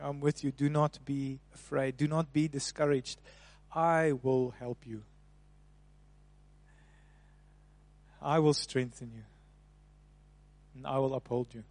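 soft reassurance. Acted. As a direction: comfort them quietly. God's promise voiced softly.

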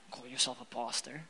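muttered mock grumble at the pastor; sulky mutter. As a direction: mutter it under your breath in mock sulk. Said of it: "I'm like".

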